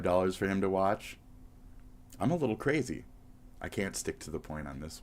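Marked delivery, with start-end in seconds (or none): none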